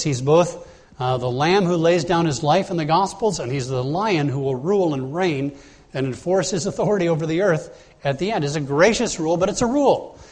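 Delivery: ordinary talk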